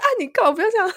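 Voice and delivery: mock-alarmed friend pushing someone off; startled roommate. Roommate protests in retold scene. (模仿室友惊慌地抗议)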